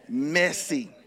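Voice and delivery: ordinary delivery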